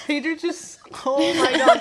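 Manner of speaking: excited. Real, not acted. Friend reacts to her lemon bite.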